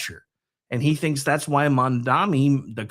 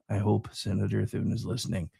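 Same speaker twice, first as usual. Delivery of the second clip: low, soft repeat under the breath; much softer and lower, almost to oneself; quiet pointed aside; 6 semitones lower, 8 dB quieter than usual. Real, not acted. Same hope repeated as a quiet aside.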